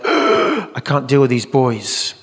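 slow, halting mock dismay; overwhelmed boy. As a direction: drag it out like a lost little boy.

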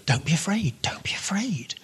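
hushed, tender whisper. Pretend. God soothing the fearful, in a tender whisper. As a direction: breathe the words like a comfort. God's reassurance voiced softly.

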